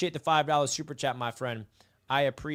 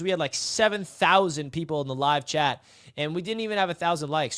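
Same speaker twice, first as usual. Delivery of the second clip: ordinary delivery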